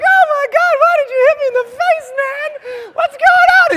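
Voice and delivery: very high, loud hysterical protest; panicked screaming. Retold or imitated. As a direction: shriek in mock outrage and panic. Screaming at being hit mid-fall.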